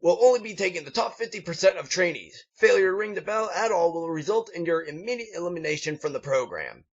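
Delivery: high, commanding instructor voice; stern drill instructor. Acted. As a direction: bark out the rules to the recruits. Instructor figure addressing recruits.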